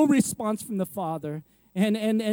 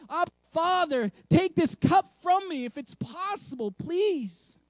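mock anguished cry; 6 semitones higher, 4 dB quieter than usual. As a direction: cry out to a father, pleading. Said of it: "And then he shouts".

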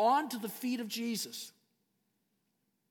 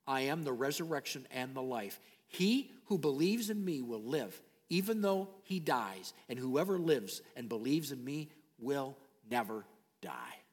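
hushed Jesus quote; 7 semitones lower, 4 dB quieter than usual. Acted. Jesus' words to Martha, hushed.

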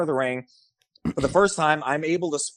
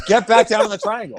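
impatient order; 7 semitones higher, 5 dB louder, faster than usual. Retold or imitated. Referee repeating order to Mike.

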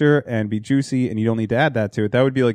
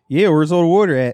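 higher, louder hammed-up Philly accent; 5 semitones higher, 5 dB louder than usual. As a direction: play a full-blown Philly local. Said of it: "I'd be like".

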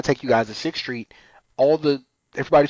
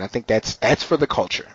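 usual speaking voice

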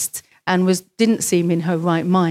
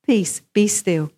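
calm command; slower than usual. Acted. Jesus calming the storm.

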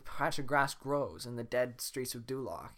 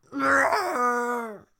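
high, loud roaring cry; dragon roar; 12 semitones higher, 11 dB louder than usual. Acted. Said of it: "And she says".

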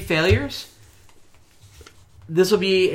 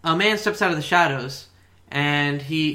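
normal talking voice

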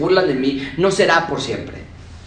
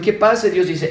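ordinary delivery

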